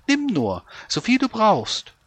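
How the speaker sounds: put-on high, eager old woman. Old woman offers groats.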